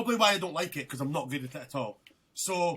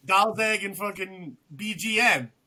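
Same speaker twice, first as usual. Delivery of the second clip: slower and louder, naming the gamertags; Xbox names; 4 semitones higher, 8 dB louder than usual. Real, not acted. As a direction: give the gamertags with mock pride. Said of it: "call him his Xbox name".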